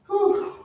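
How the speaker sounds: a high breathy whew of effort. Real, not acted. Working out, she lets out a high whew. Exertion during workout.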